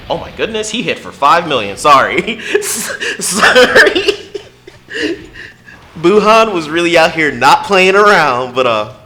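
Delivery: high, loud, startled yelling with a laugh; excited shout that gets carried away; real loud outburst. Real, not acted. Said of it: "I got a bit loud there".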